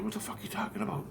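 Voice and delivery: fast, disbelieving; baffled rapid question. Retold or imitated. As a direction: blurt it out confused. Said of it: "I'm like".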